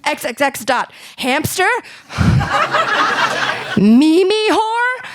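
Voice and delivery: high, rising mock-outraged listing; hammed-up shocked mom reading websites. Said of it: "She's like".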